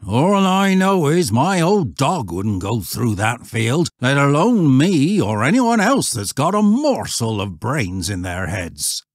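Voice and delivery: loud rustic voice, falling away at the end; emphatic countryman; warning old shepherd. Acted.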